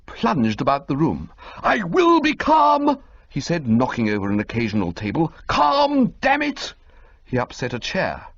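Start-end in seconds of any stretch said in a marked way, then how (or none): 1.6-3.0 high, slow shout of "I will be calm"; agitated shout. A narrator's reading. Agitated Bingo knocking furniture over. 5.5-6.7 high, exasperated "Calm, damn it!"; exasperated outburst. A narrator's reading. Agitated Bingo upsetting a chair.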